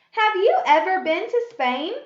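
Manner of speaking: loud, high question rising on "Spain"; question tune. Shown as a demonstration. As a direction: ask it brightly, lifting "Spain". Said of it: "A question".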